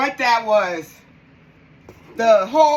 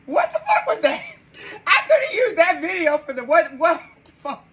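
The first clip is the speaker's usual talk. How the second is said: high laughing disbelief; disbelieving laugh; 5 semitones higher than usual. Real, not acted. Streamer reacts to a shocking clip, laughing in disbelief. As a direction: laugh through the words in shock.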